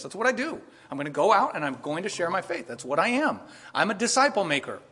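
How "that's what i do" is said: ordinary talk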